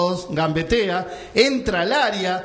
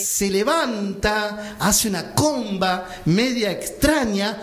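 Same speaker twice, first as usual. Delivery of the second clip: slow, stretched-out play description; suspenseful slow narration; slower than usual. Acted. Storyteller describing the ball lifting over players, slow suspense. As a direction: draw out the ball's flight. Suspenseful telling of the free kick.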